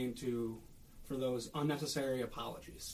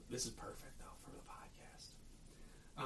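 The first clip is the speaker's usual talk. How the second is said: hushed, voiceless aside; soft whispered comment; 13 dB quieter, slower than usual. Real, not acted. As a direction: drop to a whisper, as if to yourself. Aside while finding notes.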